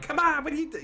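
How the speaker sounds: put-on very high, loud Boston accent; Bill Burr impression. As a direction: burst out in loud, high-pitched Boston exasperation. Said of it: "do a show with Bill Burr".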